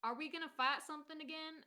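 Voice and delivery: usual speaking voice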